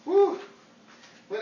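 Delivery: ordinary talk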